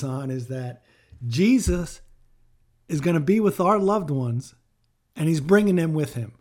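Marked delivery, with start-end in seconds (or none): 1.3-6.3 loud, ringing preacher's call; loud preaching. Real, not acted. Pastor proclaims to mourners, loud and fervent. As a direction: proclaim it loud to the grieving congregation. Preacher rising to full force.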